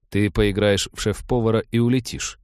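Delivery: usual speaking voice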